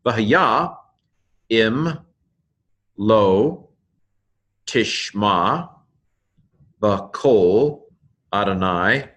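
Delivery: one word at a time with long pauses; slow pronunciation reading. Shown as a demonstration. Teacher reading a Hebrew verse for learners, slowly word by word. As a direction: sound out each Hebrew word, stretching the syllables.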